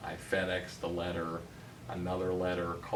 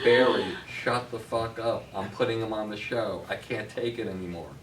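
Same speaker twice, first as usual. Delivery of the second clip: louder, higher exasperated telling-off; mock angry yell; 3 semitones higher, 6 dB louder than usual. Agent's boss yelling down the phone at Barry, louder. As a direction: bark it like a fed-up sailor.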